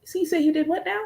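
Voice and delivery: high, mock-polite disbelief; sassy disbelief. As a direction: feign calm disbelief. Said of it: "I'm like".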